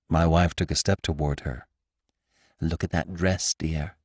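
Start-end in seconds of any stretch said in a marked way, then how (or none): 2.6-4.0 soft, hushed aside to her husband; softer hushed wifely aside; whispered. A narrator's reading. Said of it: "she whispered".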